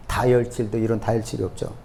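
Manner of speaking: ordinary talk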